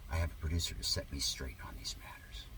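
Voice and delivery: low conspiratorial whisper; whispered aside. Acted. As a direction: murmur it as a private joke. Sarcastic aside about producer.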